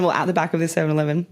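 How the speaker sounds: usual speaking voice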